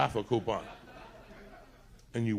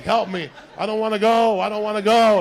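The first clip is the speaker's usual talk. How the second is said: high, loud whiny plea; mock wailing; 8 semitones higher, 12 dB louder than usual. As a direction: wail like a terrified girl. Imitating girls being pushed out.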